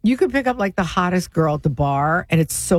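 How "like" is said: ordinary talk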